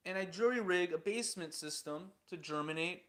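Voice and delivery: usual speaking voice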